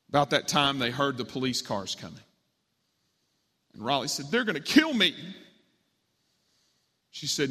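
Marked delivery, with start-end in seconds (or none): 4.3-5.2 alarmed. A narrator's reading. Fugitive hears police coming.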